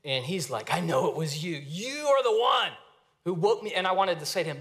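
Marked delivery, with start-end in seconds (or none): 0.7-3.7 put-on ticked-off teen. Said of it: "he's ticked off".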